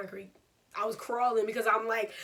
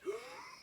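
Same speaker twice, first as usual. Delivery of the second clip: high, quiet pained intake of breath; stifled whimper of pain, acted out; sharp pained gasp; 9 dB quieter than usual. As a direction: hold the hurt in with a small gasp. Acts out her reaction on landing.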